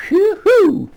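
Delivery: very high "whoo-hoo"; mock celebratory whoop. Host cheering eighteen percent inflation, mock whoop. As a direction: whoop sarcastically, high and drawn out.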